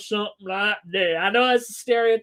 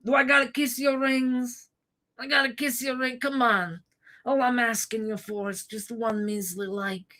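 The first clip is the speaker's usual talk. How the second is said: mock mafia plea. Streamer begging chat for likes like a Godfather petitioner. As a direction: plead like a humble Sicilian to the don. Mock Sicilian mob pleading.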